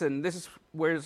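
ordinary talk, nothing marked

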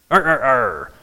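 low, throaty cartoon "arr arr arr"; imitated Popeye chuckle. Preacher recalling Popeye needing strength, imitated cartoon chuckle.

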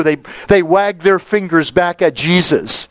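usual speaking voice

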